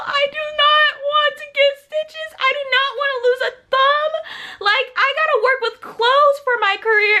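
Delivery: panicked pleading. Retold or imitated. To the nurse about stitches, high panicked whine. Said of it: "And I was like".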